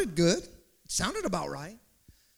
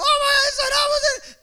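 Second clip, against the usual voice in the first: high, loud frightened shriek, dragging out "Saddam"; panicked child scream; 10 dB louder, faster than usual. Retold or imitated. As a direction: scream like a terrified kid. Child self panics again.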